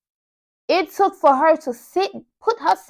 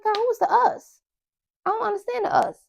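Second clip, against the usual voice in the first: higher, puzzled self-quote; asking herself in bewilderment, voice raised; confused inner question; 9 semitones higher, 5 dB quieter than usual. Retold or imitated. Herself puzzling over the movie title, high and baffled. Retelling her own confused thought.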